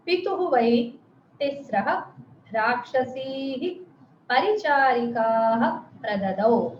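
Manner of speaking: slow, each word set apart with a rise; slow word-by-word reading. Shown as a demonstration. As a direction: read the prose order slowly, one word at a time. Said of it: "मैं अलग-अलग शब्दों जैसा पढ़ रही हूँ". (अन्वय को धीरे-धीरे शब्द-शब्द अलग पढ़ना)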